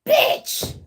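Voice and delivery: very high, loud put-on shriek; mock rock-star scream. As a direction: play an enraged rocker screaming abuse. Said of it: "someone could be Caucasian. They like".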